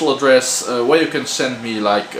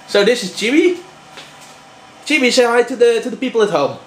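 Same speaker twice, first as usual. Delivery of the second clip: high, sweet voice for a pet; high pet talk; 9 semitones higher, faster than usual. Real, not acted. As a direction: coo at the dog.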